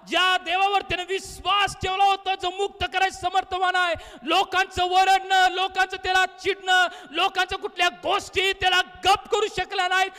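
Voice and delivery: high, impassioned sermon delivery; fervent preacher in full cry; full-force preaching. Real, not acted. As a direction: drive the faith home in ringing phrases.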